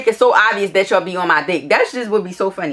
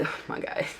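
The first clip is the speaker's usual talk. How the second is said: hushed oh my God swallowed by laughter; real laughter; 3 semitones higher, 10 dB quieter than usual. Real, not acted. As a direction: crack up mid-read, voice nearly gone. Cracks up reading his message.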